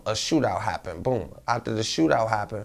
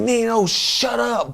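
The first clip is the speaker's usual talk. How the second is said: sharp order to shut up; 11 semitones higher, 3 dB louder, much slower than usual. Retold or imitated. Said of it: "punched him, like".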